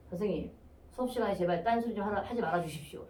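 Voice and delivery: imitating a student's low, hushed formal plea; stiffly defiant politeness. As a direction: say it low and quiet, formal but challenging. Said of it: "정중하기보다는 약간 도전적이었어".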